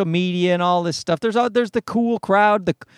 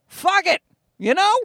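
mock blunt advice; 11 semitones higher than usual. Voicing the friend's blunt philosophy.

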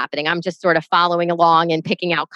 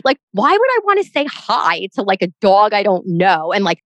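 ordinary talk

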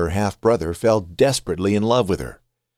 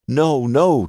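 crying out; 6 semitones higher, 3 dB louder than usual. A narrator's reading. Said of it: "Tamar cried".

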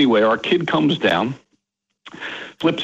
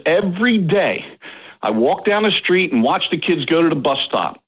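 normal talking voice

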